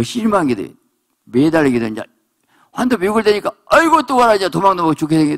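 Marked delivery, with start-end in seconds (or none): 3.7-5.4 high, loud panicked wail; cling on for dear life; desperate cry. Acted. Believer clinging to the angel, crying out in panic.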